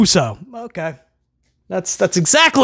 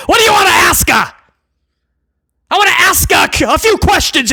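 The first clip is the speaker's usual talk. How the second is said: gleeful shout; 9 semitones higher than usual. Real, not acted. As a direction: shout the joke again, delighted. Repeating the pun.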